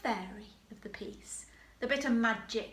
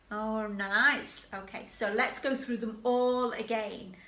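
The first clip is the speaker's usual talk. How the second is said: usual speaking voice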